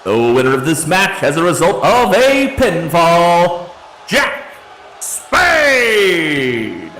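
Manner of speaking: booming arena announcer voice, drawn out; ring announcer. Acted. Ring announcer declares the winner.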